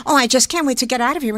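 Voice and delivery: quick, run-together chatter, not Bergman; fast American chatter. Shown as a demonstration. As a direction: rattle it off fast like a Midwesterner. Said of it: "You don't hear her talk that way".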